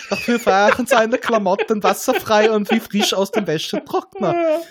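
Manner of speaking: barely holding it together; laughing while reading. Real, not acted. Reading the alien scene, laughing through it.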